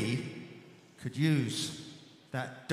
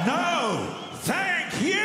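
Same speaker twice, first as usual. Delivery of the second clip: shouting; 6 dB louder, much slower than usual. Real, not acted. Shouted thanks to the crowd.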